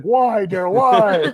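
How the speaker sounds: playful wail of protest; mock anguished protest. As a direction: plead why, hammed up. Retold protest from upset Bob.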